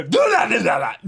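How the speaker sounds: loud, high, playful shout; mock shout. Mock shouting at the show not to kill off a character. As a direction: yell it out, half joking.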